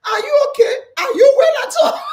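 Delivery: high, loud mock concern through laughter; mimicking concerned people. Host quoting people who ask if she's well, laughing mock concern. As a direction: imitate worried people, laughing loudly. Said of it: "People say to me".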